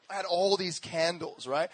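ordinary delivery

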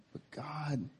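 breathy hushed whisper. Real, not acted. Preacher hushing the room before the point, whispered. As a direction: drop to a whisper for emphasis. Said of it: "sudden whisper".

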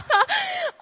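high, loud laugh that breaks out; burst of laughter. Real, not acted. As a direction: laugh out loud, almost crying with it. Bursts out laughing mid-sermon.

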